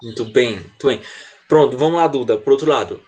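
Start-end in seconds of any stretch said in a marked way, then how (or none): none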